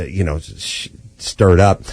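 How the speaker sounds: ordinary talk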